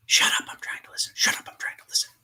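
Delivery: fast, breathy whispered echo; creepy whispered repeat; eerie whisper. Acted. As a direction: whisper it like a ghostly echo coming back. Echoed phrase repeated back.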